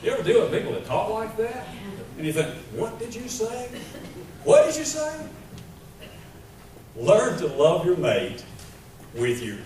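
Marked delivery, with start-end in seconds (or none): 2.7-3.7 higher-pitched, softer puzzled question; baffled listener. Acted. Preacher acting out a confused listener, puzzled and high. 4.4-5.1 very high, loud incredulous question; more baffled listener. Acted. Preacher acting out a confused listener, loud high disbelief.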